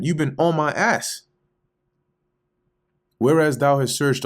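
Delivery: a wronged man pushing back, voice up. Acted. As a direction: challenge him, voice climbing with indignation. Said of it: "voices being raised".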